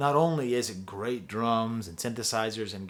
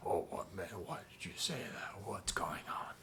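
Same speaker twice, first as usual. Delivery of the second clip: hushed whispery mumble, far softer; guttural singer imitation; 4 semitones lower, 13 dB quieter than usual. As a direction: mumble it like sleepless thoughts in your head. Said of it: "these are the words that you hear".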